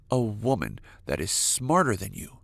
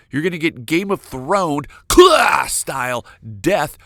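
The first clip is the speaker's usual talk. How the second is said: exasperated scolding; 3 semitones higher, 6 dB louder than usual. Acted. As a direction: yell at a reckless fool in comic exasperation. Exasperated scolding of careless man.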